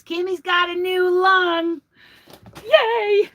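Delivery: high, drawn-out happy cheer; sing-song celebration, rising to a "yay"; celebratory cheer. Real, not acted. Cheering a friend's new lung.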